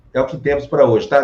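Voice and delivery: normal talking voice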